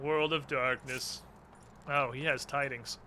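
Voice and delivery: ordinary talk, nothing marked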